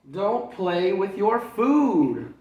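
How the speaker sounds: slow, loud, high reprimand; stern mother at the dinner table; mom scolding. Acted. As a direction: spell out each word firmly.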